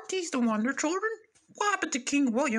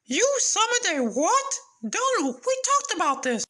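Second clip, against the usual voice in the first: shocked scolding; 8 semitones higher, 4 dB louder than usual. Acted. King scolds the servant.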